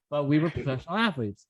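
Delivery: ordinary talk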